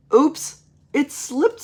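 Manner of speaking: put-on high, slow little-kid oops; sheepish child. David owning up to a spill, high drawn-out oops. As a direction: draw it out high like a kid caught out.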